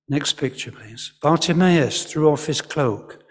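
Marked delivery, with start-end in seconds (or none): none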